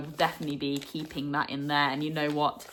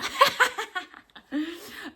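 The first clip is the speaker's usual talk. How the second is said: high amused giggle; a real giggle, tickled; 12 semitones higher than usual. Real, not acted. Vlogger giggling at a fan's nickname for him.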